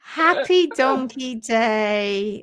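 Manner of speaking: slow and stretched; slow cheerful Happy Donkey Day; drawn-out greeting. Acted. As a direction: draw each word out. Slow drawn greeting after laughing.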